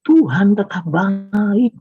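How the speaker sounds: high, long-drawn declaration of faith; emphatic proclamation. Real, not acted. Preacher declaring God's goodness to the congregation, emphatic and drawn out. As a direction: stretch it out high, a triumphant refrain. Climax of the sermon's refrain.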